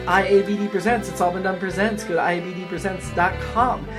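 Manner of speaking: ordinary talk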